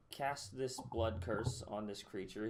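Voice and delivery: ordinary delivery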